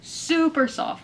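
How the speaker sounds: Super stretched slow and high; drawn-out super. Real, not acted. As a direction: linger on Super. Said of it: "honestly so soft".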